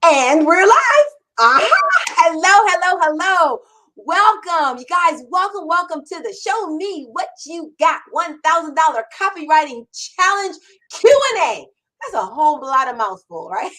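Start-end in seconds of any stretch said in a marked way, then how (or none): 0.0-1.7 slow, loud, bright announcement of going live; excited opening. Real, not acted. Host opening live show. 2.1-11.7 loud, high, eager welcome to the show; excited greeting. Real, not acted. Host greets live audience.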